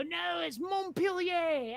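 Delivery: slow, drawn-out French way of saying Montpelier; French pronunciation. Retold or imitated. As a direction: say Montpelier slowly the snooty French way. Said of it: "like the French, rebel and go".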